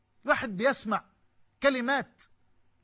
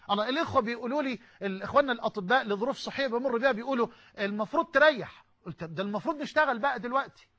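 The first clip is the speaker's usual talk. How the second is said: ordinary delivery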